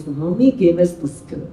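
happy intonation. Retold or imitated. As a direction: say it as if pleased, softly understated. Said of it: "how happy it is".

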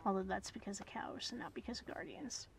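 soft whispered aside; whispering. Real, not acted.

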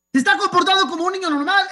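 más agudo y rápido, enfático; defiéndelo con fuerza; insistencia indignada. Retold or imitated. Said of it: "Yo estaba pensando". (alto y rápido, indignado)

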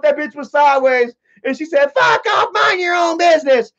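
exasperated neighbor venting about the noisy couple; fed-up neighbor. Retold or imitated. Neighbor voiced complaining about couple.

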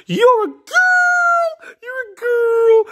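drawn-out, slow yell; exasperated shout. Acted. Director telling player why she is Peach, exasperated shout. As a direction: holler the obvious, stretching the words.

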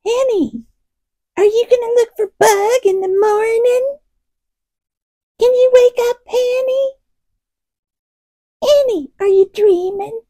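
very high, squeaky pet-talk; kitty voice. Acted. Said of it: "It wasn't like".

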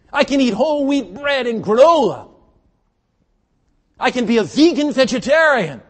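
high, sustained pulpit shout; full-force preaching. Real, not acted. Preacher warning health-minded listeners about stress, full-force high shout. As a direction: drive each point home at the top of the voice.

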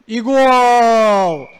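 loud stretched-out goal; goal shout. Real, not acted. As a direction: belt out the goal. Goal call. (громкий протяжный крик)

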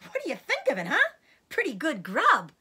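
high, loud, delighted boasting; gleeful fox showing off his find; exultant cry. A narrator's reading. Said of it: "cried Mister Fox, dancing up and down".

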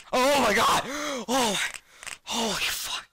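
high, loud shriek of real fright; jump-scare shock, blurted out; startled scream. Real, not acted. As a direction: yelp in panic as if something leapt out. Jump scare while reading.

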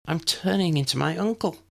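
inner thought voice. A narrator's reading. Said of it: "she thought".

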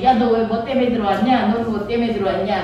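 low-voiced fellow inmate; prisoner chat. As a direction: lightly play a convict sizing up a cellmate. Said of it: "죄수들하고 얘기를 나누지". (낮은 목소리의 죄수 말투)

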